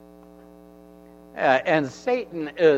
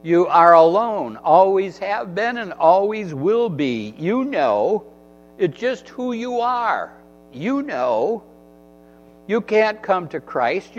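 louder, higher taunting accuser; Satan's accusing voice; 4 semitones higher, 5 dB louder than usual. Acted. Satan whispering lies to the believer, loud mocking accuser. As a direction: jab each insult at the listener like the devil. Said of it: "that's what Satan does to us. He says".

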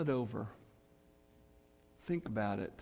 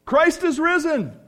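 loud, high call to the congregation; booming Easter acclamation; exuberant proclamation; 12 semitones higher, 13 dB louder than usual. Acted. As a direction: shout it out to draw a response. Calls congregation to respond like friend.

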